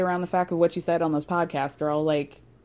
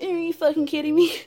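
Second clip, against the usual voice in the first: parroting mockery, higher and louder; higher, louder mocking copy of the same line; mocking echo; 7 semitones higher, 3 dB louder than usual. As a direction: mimic her words back at her. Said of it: "So Lala starts saying".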